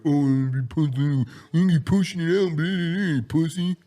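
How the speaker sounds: loud, high mock whining; mocking impression. Podcaster mimicking co-host scolding a cancellation, mocking impression. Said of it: "That was the worst impression".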